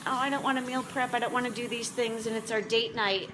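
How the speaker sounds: ordinary delivery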